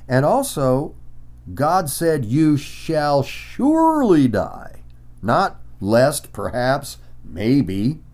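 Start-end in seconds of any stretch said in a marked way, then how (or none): 2.2-4.8 SURELY drawn out, high and heavy; slow, forceful weight on "surely", falling onto "die"; emphatic. Retold or imitated. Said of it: "God was very emphatic".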